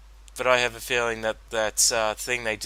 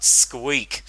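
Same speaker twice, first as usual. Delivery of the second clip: mock call out; 5 dB louder than usual. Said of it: "I might yell out, like, call out".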